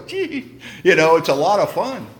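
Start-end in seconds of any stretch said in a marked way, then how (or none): 0.1-0.6 tiny high squeal; soft, high-pitched little squeak; delighted squeak. Acted. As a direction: let out a small, high 'gee'. Acts out own reaction at service.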